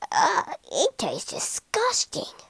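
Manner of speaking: soft, high, slow revulsion; whispery monkey disgust. A narrator's reading. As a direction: groan it out, half whispered. Monkey disgusted by fire water.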